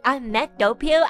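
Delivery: ordinary talk, nothing marked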